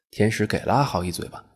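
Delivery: usual speaking voice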